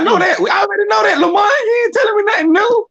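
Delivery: high, strained protest at full voice; exasperated high yell. Real, not acted. Friend shouting high and exasperated at Lamar for old news. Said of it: "Oh, my goodness".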